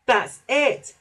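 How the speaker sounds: high, loud eureka cry; excited realisation. A narrator's reading. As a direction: burst out as memory returns. Percy suddenly remembers the job.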